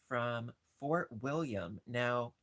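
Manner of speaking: usual speaking voice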